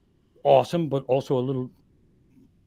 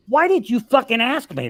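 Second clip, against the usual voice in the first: raised, higher mock-indignant snap; exasperated outburst; 8 semitones higher, 5 dB louder than usual. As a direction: fire it back at the nosy asker. Exasperated rant at people who ask.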